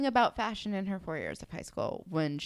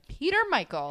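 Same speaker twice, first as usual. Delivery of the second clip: higher, louder full-name scold; a hint of stern mother; scolding mom; 9 semitones higher, 4 dB louder than usual. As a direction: call him like a mom who caught him.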